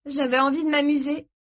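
high, strained admission; tense. A narrator's reading. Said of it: "d'une voix tendue". (d'une voix tendue)

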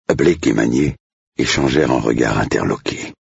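plain reading voice

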